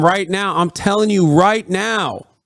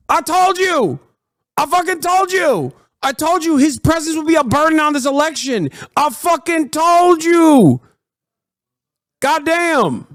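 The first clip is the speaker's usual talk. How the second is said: triumphant gloat; 8 semitones higher than usual. Real, not acted. Gloating over the prediction.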